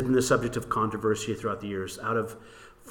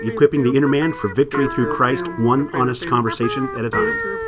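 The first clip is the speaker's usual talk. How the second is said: loud, punchy announcer read; radio show intro; 5 dB louder than usual. Acted. Two hosts opening their Bible radio show, loud announcer voice. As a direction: announce it big over the theme music.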